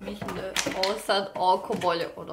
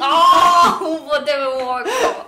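high laughing yell, stretched out; pained shout; 6 semitones higher, 13 dB louder, much slower than usual. Real, not acted. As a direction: cry out at the poke, half laughing. Poked in the eye, yelps.

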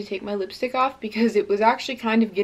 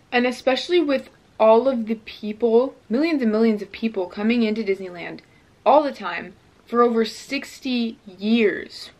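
ordinary delivery

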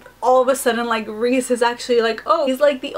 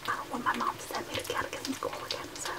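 barely audible whisper; 12 dB quieter than usual. Real, not acted. Explaining why she can't play the audiobook, whispered. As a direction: keep it down so mom can't hear. Whispering, parents are home.

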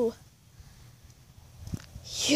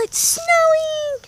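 high drawn-out squeal, much higher and slow; excited squeal; 13 semitones higher, 3 dB louder, much slower than usual. Real, not acted. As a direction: squeal it with pure delight. Thrilled shout about the snow.

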